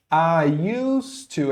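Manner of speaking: "I used to" slowly, linked as "I yoos-too"; slow teacher's demo of "I used to"; model pronunciation. Shown as a demonstration. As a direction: stretch "I used to" for learners, s unvoiced and d silent. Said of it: "Essa é a pronúncia".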